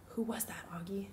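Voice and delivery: soft, almost whispered ask; hushed question. A narrator's reading. Mom quietly asking her son about the girl, hushed. Mom asks once away from crowd.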